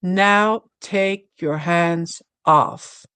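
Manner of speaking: slow, measured retold instruction; clear guidance. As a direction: say it slowly, word by word, as clear guidance. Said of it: "I heard clear guidance".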